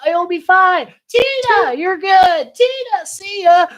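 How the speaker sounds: very high put-on voice; mock breezy send-off. Imitating Tina leaving for the party, high mock breeziness. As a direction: toss it off high and singsong.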